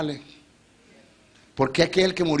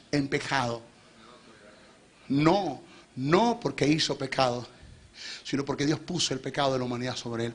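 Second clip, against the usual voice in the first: usual speaking voice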